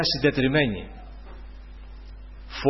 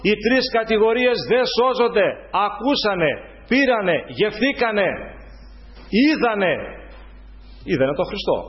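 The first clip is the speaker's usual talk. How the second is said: preaching; 5 semitones higher than usual. Acted. Preacher condemning the scribes to the congregation, in full preaching voice. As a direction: hammer each phrase from the pulpit.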